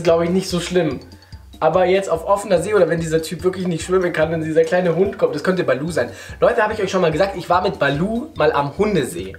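usual speaking voice

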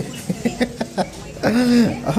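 high drawn-out laugh. Real, not acted. Vlogger amused at the stitch check, laughing. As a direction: laugh it out at length.

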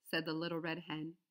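usual speaking voice

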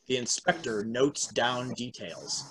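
ordinary delivery

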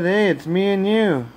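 plain reading voice

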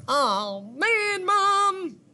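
put-on high, drawn-out kid complaint; protesting child. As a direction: pretend whine leaping up high. Centipede protests bedtime.

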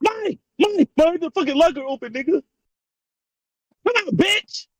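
high, frantic calls for help; panicked yelling. Real, not acted. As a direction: yell in real fright. Locker opens, panicked calls.

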